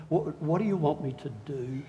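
soft, awed question; awed Paul. Retold or imitated. Paul to Jesus, hushed and awed. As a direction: ask it hushed, in awe of Jesus. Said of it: "Paul ... said".

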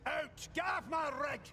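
furious order to leave; shouting. Acted. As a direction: roar him out of the room. Boss throws him out.

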